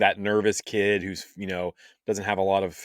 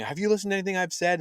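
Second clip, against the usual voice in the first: high, quick yell without the volume; mock yell; 11 semitones higher, faster than usual. Jod yelling at the kids in a retold scene, mock yell. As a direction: imitate an exasperated yell, pitched up and rapid. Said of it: "like, yelling".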